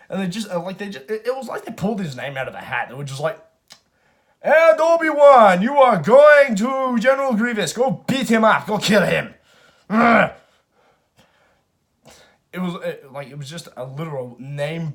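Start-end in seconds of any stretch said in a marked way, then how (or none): 4.4-9.3 high, very loud, drawn-out hammed-up order; mock booming proclamation. Council pulling Obi-Wan's name from a hat, hammed-up bellow. 9.9-10.3 high, forceful hammed-up grunt; loud mock grunt. Council sending Obi-Wan to kill Grievous, mock grunt. Caps the bellowed mock order.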